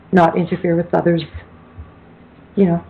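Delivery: ordinary talk, nothing marked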